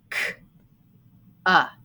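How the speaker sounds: ordinary talk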